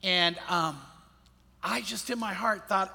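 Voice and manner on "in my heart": ordinary talk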